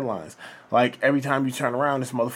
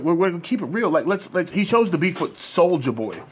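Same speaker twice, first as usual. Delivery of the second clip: normal talking voice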